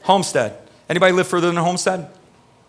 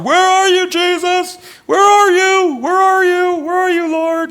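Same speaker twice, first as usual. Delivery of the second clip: high, loud desperate wail of a lost people; crying out to God; 6 dB louder, slower than usual. Retold or imitated. People of Isaiah crying out to the Lord, high desperate wail. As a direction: cry out to the Lord, pleading and lost. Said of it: "they're crying out".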